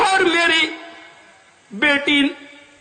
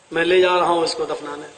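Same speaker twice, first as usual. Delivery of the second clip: much lower, fast and cold; grim father's decision; 11 semitones lower, faster than usual. Acted. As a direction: announce it coldly to the wife. Angry father announces burial.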